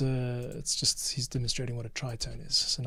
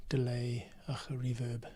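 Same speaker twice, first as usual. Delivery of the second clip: whisper; 7 dB quieter than usual. Real, not acted. Editor mutters over playback.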